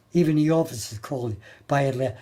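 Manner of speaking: ordinary delivery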